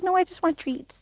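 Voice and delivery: a hint of a whiny puppy; voicing the dog. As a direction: speak for the stubborn dog. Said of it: "He says".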